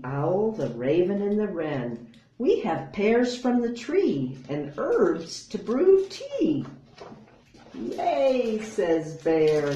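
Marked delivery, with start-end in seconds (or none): 2.4-6.7 high, louder bird voices rising on tea; chirpy birds. Acted. The birds bringing pears and tea, chirpy and high. 7.7-8.6 high, loud cheer; excited yay. Acted. Bear cheering at the party, high and loud.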